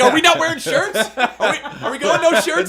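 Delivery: put-on high, loud kid voice, laughing; hammed-up excited little boy; excited young son. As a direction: imitate an eager child checking with everyone. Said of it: "My son, if there's any human".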